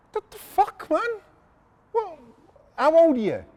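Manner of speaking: high-pitched mock disbelief; incredulous outburst. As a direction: squawk it in utter astonishment. Acting astonishment at young man's career.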